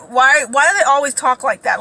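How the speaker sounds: usual speaking voice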